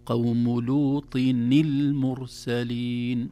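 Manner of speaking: "لوط" linked with a kasra n into "المرسلين", slow; tajweed linking demo; slow recitation. Shown as a demonstration. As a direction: recite slowly, showing the kasra on the nun. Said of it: "يتحرك إلى الكسر مثل هذه".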